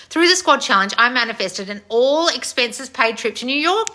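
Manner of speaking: loud, high, eager announcement; excited read-out. Real, not acted. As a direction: read out big news with real excitement. Reads fan's trip news excitedly.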